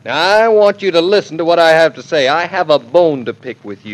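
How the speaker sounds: forceful, self-important telling-off; manly sternness. Acted. Husband confronting his mother-in-law by phone, manly and stern. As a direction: lay down the law, starting high and loud. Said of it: "you sound so manly".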